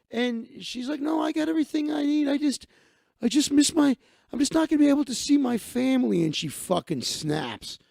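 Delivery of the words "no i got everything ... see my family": high-pitched imitation of someone close to tears; mock upset. Woman tells friend she misses family, mock upset. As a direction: hurry it out as if holding back tears.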